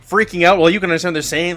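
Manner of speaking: ordinary delivery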